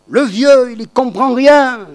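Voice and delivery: loud, high, contemptuous youth; scornful young son. Retold or imitated. The rebellious son mocking his father, loud and scornful. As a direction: sneer at the old man. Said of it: "C'est comme ça qu'il aurait parlé".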